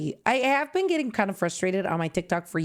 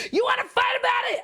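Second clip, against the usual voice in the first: much higher, shouted playful threat; mock challenge; 13 semitones higher, faster than usual. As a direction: jokingly pick a fight with fake fans. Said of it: "I'm kidding".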